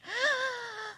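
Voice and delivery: high sharp gasp of dismay. A narrator's reading. Ziz seeing the smashed garden, dismayed gasp. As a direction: gasp at the ruin.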